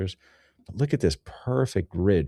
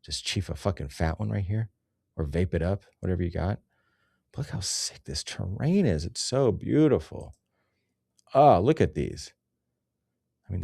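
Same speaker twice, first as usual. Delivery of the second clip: normal talking voice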